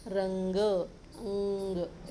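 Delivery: रंग very drawn out, then the nasal ng alone as अंग; ng sound shown. Shown as a demonstration. Teacher showing children the sound, रंग slowly broken down to ng. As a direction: stretch रंग and isolate its ng. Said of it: "ऐसा आया इसका pronunciation".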